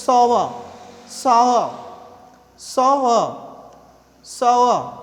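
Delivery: sour drawn out as "saa-wa", no hard r; correct sour. Shown as a demonstration. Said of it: "that is". (sour का सही उच्चारण)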